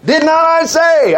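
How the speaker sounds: high, slow, emphatic retort; exasperated mock reprimand; acted rebuke. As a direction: retell a scolding reply, drawing out each word. Acting out Jesus rebuking the doubters.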